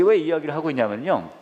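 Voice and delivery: ordinary talk, nothing marked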